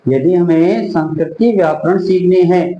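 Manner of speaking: usual speaking voice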